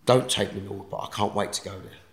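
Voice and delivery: normal talking voice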